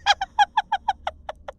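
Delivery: short, high villainous giggle; evil giggle. Acted. Master pleased he hid the scroll, high evil giggle. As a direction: giggle at your own cleverness.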